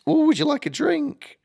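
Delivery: high, sweet put-on woman's voice; waitress voice. Waitress offering the gambler a drink, high sweet voice. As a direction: chirp the offer like a bubbly waitress. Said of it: "and she's like".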